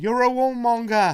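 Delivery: a hint of an English heckler's accent; mock English accent. Co-host imitating the Belfast heckler, lightly English-accented. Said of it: "in that English accent".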